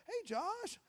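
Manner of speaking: high sing-song hey to Josh; mock calling out. Me calling to the dealership owner, hammed-up. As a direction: call over eagerly, mock friendly.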